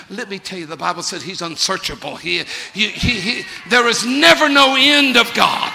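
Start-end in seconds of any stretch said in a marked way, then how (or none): 2.7-5.7 loud, high cry from the pulpit; preaching shout. Real, not acted. Preacher to congregation, high loud proclaiming shout. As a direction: ring it out to the back pews.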